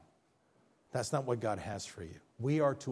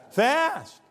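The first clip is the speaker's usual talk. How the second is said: emphatic answer; 14 semitones higher, 9 dB louder than usual. Real, not acted. Answering his own question emphatically.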